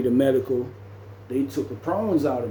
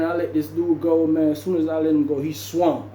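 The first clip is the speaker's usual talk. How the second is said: ordinary talk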